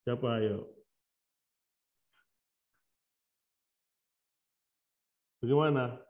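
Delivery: faint, distant voice far from the mic; mic too far. Real, not acted. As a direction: sound far off the microphone. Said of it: "Suaranya kecil banget".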